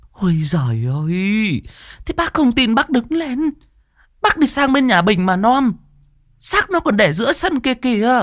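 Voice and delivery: high pitched, exasperated village woman. Acted. Mai urges old Cẩm to go look, high exasperated woman. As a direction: sigh then urge him, high.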